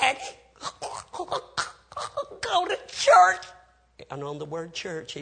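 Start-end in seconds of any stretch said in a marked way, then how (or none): 0.0-3.5 struggling, high, loud last words; dying gasp. Retold or imitated. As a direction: force each word out with great effort, long gaps between. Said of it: "say these words as best he could".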